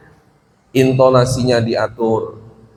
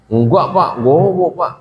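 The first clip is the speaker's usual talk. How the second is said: pupil's protest; 3 semitones higher than usual. Acted. Pupil answers teacher about the forgotten book, protesting.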